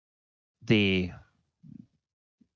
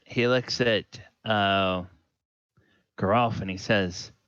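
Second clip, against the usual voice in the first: normal talking voice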